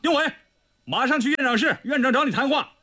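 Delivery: calling out. A narrator's reading. Someone outside summoning Ding Wei, calling out. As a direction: call his name across the yard. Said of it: "窗外有人喊道".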